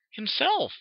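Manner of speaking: very high, airy single word; high-pitched acted sigh. A narrator's reading. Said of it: "she sighed".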